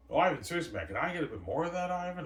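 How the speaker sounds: high, loud wheedling; a hint of a greedy director; whiny begging. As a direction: beg for a bigger cut.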